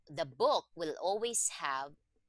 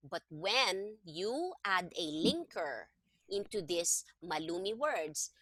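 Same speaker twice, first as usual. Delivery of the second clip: ordinary talk, nothing marked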